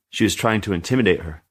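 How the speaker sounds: ordinary delivery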